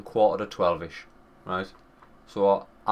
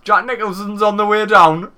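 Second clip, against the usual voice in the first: excited; 6 semitones higher, 8 dB louder than usual. Retold or imitated. Retelling panic, laughing.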